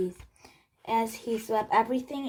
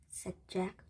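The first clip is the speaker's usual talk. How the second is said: ordinary delivery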